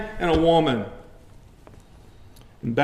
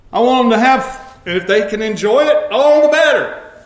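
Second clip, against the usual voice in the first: higher, louder preacher's cry against sin; preaching at full force; 8 semitones higher, 9 dB louder than usual. Acted. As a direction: build to a shout over sin. Preacher peaks with a joke.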